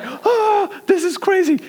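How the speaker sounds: very high, thrilled squeal; acted giddy exclamation. Storyteller reliving slapping a buffalo, high excited squeal. Acts out own thrilled reaction.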